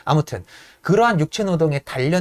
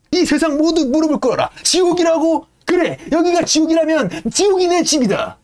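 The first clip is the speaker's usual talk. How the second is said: much higher, building brute tirade; Yank's rant; 9 semitones higher than usual. Retold or imitated. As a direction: rant that the stokers are the steel. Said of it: "양크가 반응하는 거죠". (롱에게 반박하는 양크, 점점 높아지는 큰소리)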